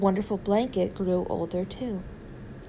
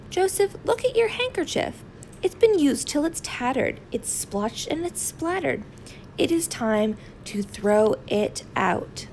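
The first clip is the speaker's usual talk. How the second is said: high-pitched fussy mother voice; a mother fussing over a worn-out blanket; scolding mother; 6 semitones higher than usual. Acted. Mother scolding about handkerchief.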